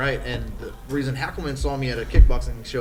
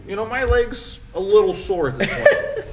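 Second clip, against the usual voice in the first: higher, laughing fake toughness; laughing bravado; 9 semitones higher, 5 dB louder than usual. Downplaying a broken-leg sparring injury.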